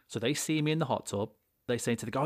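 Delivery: normal talking voice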